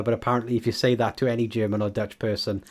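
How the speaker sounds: usual speaking voice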